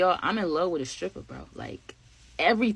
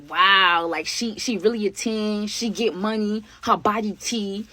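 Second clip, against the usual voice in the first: ordinary talk, nothing marked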